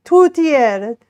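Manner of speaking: Tortiere said with a mock Italian accent, rolled and sung. Shown as a demonstration. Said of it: "Oh, no, that's an Italian accent".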